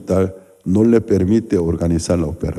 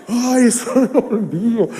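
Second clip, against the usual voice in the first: laughing; 13 semitones higher than usual. Real, not acted. Recalling the gruesome injury.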